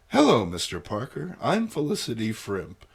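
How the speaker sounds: usual speaking voice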